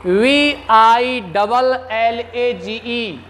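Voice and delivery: letter by letter, slowly, for students; teacher dictating the spelling slowly. Shown as a demonstration. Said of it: "spelling out the word".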